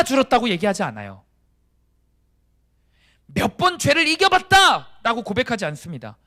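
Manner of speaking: loud, high, emphatic declaration; forceful preaching. Real, not acted. Preacher declaring the death of self to sin, forceful preaching. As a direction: drive each phrase out at full force.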